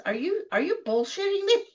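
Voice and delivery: boss in disbelief. Retold or imitated. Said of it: "she says".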